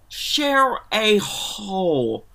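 "Share a hole" drawn out very slowly, each word stretched; slow mock mishearing. Host retelling a guest's slow, stretched mishearing of "Share a hole". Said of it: "And she was like".

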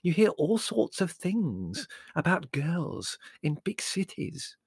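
high, slow, ominous warning; foreboding, scandalised mother; dark. Acted. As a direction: draw it out darkly, fading to a hush. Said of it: "said Mother darkly".

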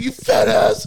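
high, loud retold yell; losing it. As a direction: imitate an outraged friend yelling an insult. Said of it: "Keegan loses his mind".

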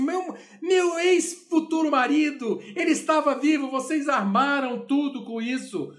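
hammed-up furious outburst at being tricked; fúria imitada. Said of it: "a Katixá fica furiosa". (grito furioso encenado, mais agudo e mais alto)

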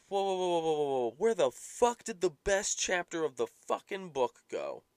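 loud, high indignant whoa; outraged disbelief. Retold or imitated. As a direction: protest like a betrayed fan. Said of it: "And I'm like".

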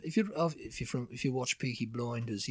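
Brummie talk in the Peaky Blinders vein; Birmingham accent. Shown as a demonstration. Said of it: "do a Birmingham accent".

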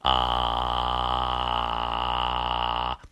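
low rattling fry, vocal folds buzzing; low edge voice; relaxed vocal fry. Shown as a demonstration. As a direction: loose slow creak on ah. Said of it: "リラックスした状態で、低い音で".

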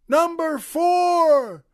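high, loud, long-held holler; yelling. Acted. Another inmate calling from down the cell block, yelling. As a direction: yell it from the far end. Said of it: "He yells".

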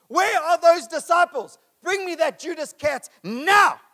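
loud, slow, high pretend outburst; mock angry demand. Preacher imagining what he'd shout at the disciples, hammed-up loud. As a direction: bark it like an indignant boss. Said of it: "my first words would have been".

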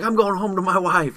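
high loud yell; yelling quote. Retold or imitated. Brian shouting his will to survive, high loud yell. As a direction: bellow it like a vow.

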